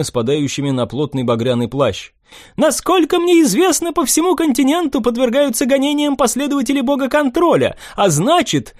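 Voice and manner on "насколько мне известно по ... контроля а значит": put-on high light young nobleman; young prince voice.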